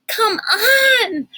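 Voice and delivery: high, loud breathless urging; urgent and out of breath. A narrator's reading. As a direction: gasp it out, hurrying her along. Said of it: "Kate urged, panting for breath".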